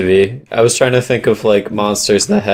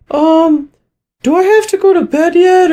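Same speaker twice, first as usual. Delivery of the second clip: put-on high little kid pleading; scared kid voice. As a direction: whine like a frightened child. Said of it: "get up and be like".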